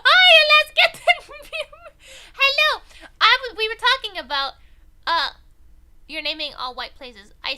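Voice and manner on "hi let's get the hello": high, loud, bubbly hello to chat; squealy excited welcome; excited stream greeting. Real, not acted. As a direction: greet the new viewer with a burst of joy. Streamer greeting a new viewer.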